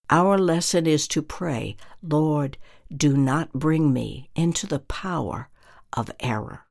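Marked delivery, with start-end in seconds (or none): none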